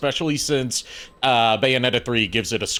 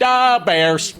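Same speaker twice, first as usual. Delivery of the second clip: put-on higher, louder "bears" in a jokey Chicago accent; mock Chicago accent; 8 semitones higher, 6 dB louder than usual. Teasing Chicago co-hosts with a loud mock Chicago "bears". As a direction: bark "bears" like a Chicago sports fan. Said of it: "we do not talk like that".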